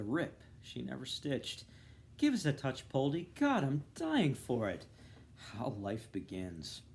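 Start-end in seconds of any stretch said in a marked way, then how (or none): 2.2-4.9 Molly's teasing plea. Retold or imitated. Molly's tease recalled by Bloom.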